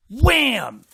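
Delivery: high, loud, punchy Wham; loud sound effect. Acted. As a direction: smack it out like a comic-book hit. Swatting monster with broom.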